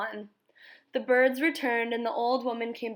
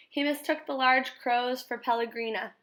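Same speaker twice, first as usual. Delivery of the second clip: ordinary delivery, nothing marked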